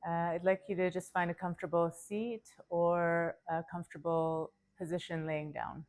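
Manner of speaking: calm, unhurried yoga nidra guidance; guided meditation. Acted. Yoga teacher settling listeners before practice, calm guided meditation. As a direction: settle them in with measured phrases and long pauses.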